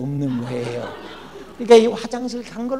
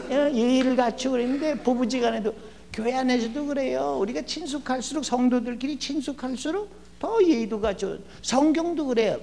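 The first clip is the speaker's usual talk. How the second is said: ordinary talk, nothing marked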